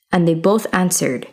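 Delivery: usual speaking voice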